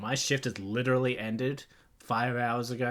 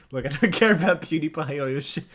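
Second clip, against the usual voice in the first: loud, laughing rant; cracking up while scolding; 5 semitones higher, 10 dB louder than usual. Real, not acted. Streamer laughing at the AI's reply.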